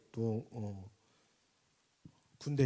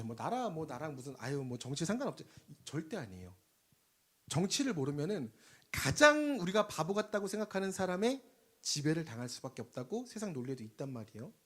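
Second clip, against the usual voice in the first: ordinary talk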